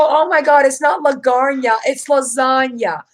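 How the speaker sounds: first "lasagna" as la-GARN-ya, second as la-ZAHN-ya; loud, high correction, slowing on the right "lasagna", la-ZAHN-ya; exasperated correction. Retold or imitated. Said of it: "I go".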